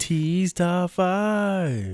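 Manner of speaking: high, long-drawn segment title; drawn-out high announcer call; segment jingle. Pretend. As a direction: stretch every word out high like a jingle.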